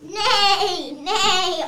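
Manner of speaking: mock horse neigh, voiced as words. Actress making horse noises on the plastic horse, mock neigh. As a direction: fake a neigh with the mouth. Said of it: "You can make horse noises".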